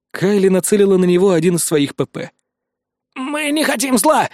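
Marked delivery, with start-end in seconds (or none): 3.1-4.3 high, slow, frightened voice, barely hushed; frightened whisper. Acted. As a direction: plead slowly in a pitched-up, scared voice. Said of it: "прошептал он". (испуганный высокий голос, медленно)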